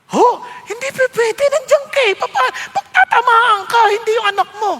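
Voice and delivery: high, loud panicked cry of protest; alarmed protest. Acted. Executioner pleads with the father to step aside, high alarmed cry.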